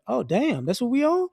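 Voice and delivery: high, shocked "oh, damn"; mock betrayed surprise; stunned realization. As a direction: caught off guard, rising disbelief. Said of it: "it's like".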